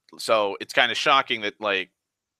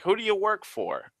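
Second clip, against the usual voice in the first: curious stranger; 11 semitones higher than usual. Retold or imitated. Voicing curious man at diner.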